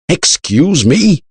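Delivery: slow, high, shocked; shocked cry. A narrator's reading. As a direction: blurt it out in disbelief. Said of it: "cried Shiva and Sati".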